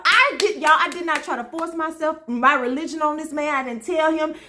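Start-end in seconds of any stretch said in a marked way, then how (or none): none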